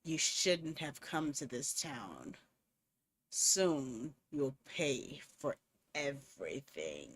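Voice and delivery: low, slow, hoarse threatening voice; deep gruff villain on the phone; gruff menacing caller. Acted. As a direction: growl it like a faceless stalker. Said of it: "a hoarse, gruff voice".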